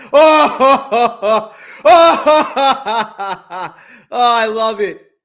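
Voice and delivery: high, loud words through laughter, the last ones slow; cracking up while gloating; laughing. Real, not acted. Laughing hard at his own losses.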